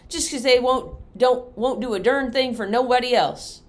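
high, loud whiny cousin; whining. Acted. Cousin Pete whining to Pa about the mules. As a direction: whine like a sulky boy. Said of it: "Pete whined".

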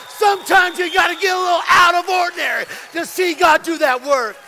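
loud, driving preacher's delivery; fired-up preaching. Real, not acted. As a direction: preach it with full force, punching key phrases. Preaching at full force.

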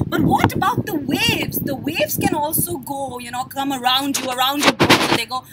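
normal talking voice

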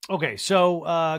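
normal talking voice

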